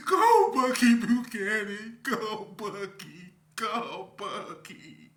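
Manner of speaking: high, loud, slow chant through laughter; laughing mock cheer. Real, not acted. Teasing the guys on the phone with a laughing chant. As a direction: cheer them on while cracking up.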